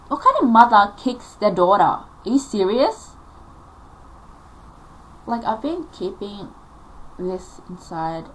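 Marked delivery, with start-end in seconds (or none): 0.1-3.2 loud, incredulous outburst; real outrage, voice jumping louder; indignant. Real, not acted. Daughter condemning mum for kicking her, indignant.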